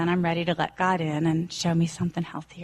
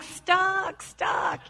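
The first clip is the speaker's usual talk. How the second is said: high, breathy mock toddler wail; toddler cry; 11 semitones higher than usual. Speaker imitates herself as a stuck toddler, high mock wail. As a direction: play yourself as the stuck child. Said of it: "I'm like".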